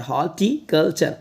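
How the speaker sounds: Horticulture slowly split "hor - ti - kal - char"; syllable by syllable. Shown as a demonstration. Teacher practising a word with students, horticulture in slow parts. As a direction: sound horticulture out part by part. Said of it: "इस word की practice करते हैं".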